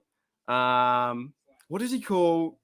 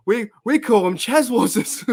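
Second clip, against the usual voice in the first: put-on high, squeaky Australian shop kid; squeaky Australian guy; 8 semitones higher, 5 dB louder than usual. Squeaky Aussie shop kid tells Marge the frog name, high and loud. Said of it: "And the team goes".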